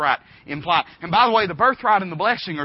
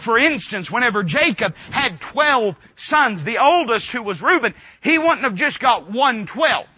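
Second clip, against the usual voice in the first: louder, raised preaching voice; preaching at full force; 4 semitones higher, 6 dB louder than usual. Acted. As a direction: drive it home to the congregation.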